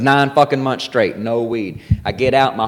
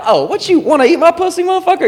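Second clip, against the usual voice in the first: much higher, mocking woman's voice; brash comic impression; faster than usual. Imitating a bold comedian on stage, brash challenge. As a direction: call him out in a high sassy voice. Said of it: "But Nikki will be like".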